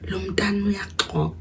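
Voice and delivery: "Lo mntana uyaxoka" whispered softly, as if heard through a door; overheard whisper. Retold or imitated. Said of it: "I hear my dad saying".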